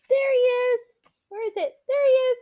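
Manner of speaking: high, loud, delighted discovery; excited find. Pretend. Teacher showing kids the hidden fox, excited. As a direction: call out like you just spotted him.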